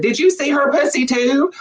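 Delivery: high cheeky parrot voice; parrot punchline. Acted. Parrot asking the dead chicken in a high put-on voice. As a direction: deliver it as the gleeful parrot.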